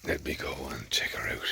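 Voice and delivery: soft whisper near the cow. Real, not acted. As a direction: whisper it so the cow stays calm. Creeping up on calving cow.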